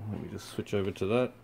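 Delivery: ordinary talk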